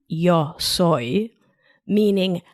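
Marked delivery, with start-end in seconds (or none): 0.1-1.3 standard Spanish yo soy, a clean y sound; non-Argentine Spanish accent. Shown as a demonstration. Host shows textbook Spanish to her listeners, neutral accent. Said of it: "in a not Argentine accent".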